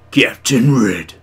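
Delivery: slow, loud character voice; Captain Red. Acted. Trying the viewer's new voice on the name, loud and drawn out. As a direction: announce it slowly in a booming put-on voice. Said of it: "That's your voice".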